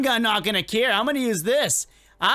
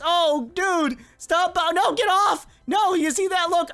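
protesting yell; 7 semitones higher than usual. Real, not acted. Player pushing him, protesting.

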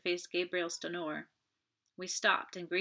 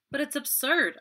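loud, higher protest; outburst; 8 semitones higher, 8 dB louder than usual. A narrator's reading. Hastings cuts into Stenord's doubts, crying out in protest. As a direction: burst in, exclaiming. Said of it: "I cried, bursting in".

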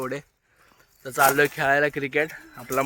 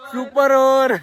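loud, high yell of super over; thrilled cricket yell; excited shout; 9 semitones higher, 9 dB louder, slower than usual. Real, not acted. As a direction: shout it out in delight. Match goes to super over.

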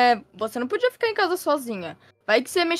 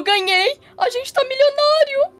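excited; 13 semitones higher than usual. Acted. Father announces lottery win.

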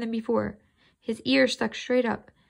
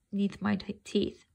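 plain reading voice

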